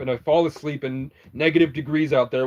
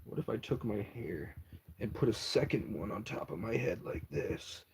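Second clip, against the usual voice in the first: much softer, low playful wondering; quiet musing; 5 semitones lower, 15 dB quieter than usual. Real, not acted. Asking the viewers about his hair, very softly. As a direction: muse it under your breath.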